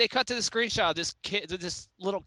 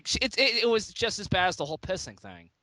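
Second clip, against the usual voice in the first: ordinary talk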